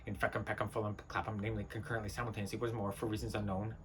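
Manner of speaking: low, very soft monotone ramble; subdued muttering. Acted. Rambling on for reasons unknown, muttered low. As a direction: let the rant sink into a mumble. Acted monologue sinking to a mutter.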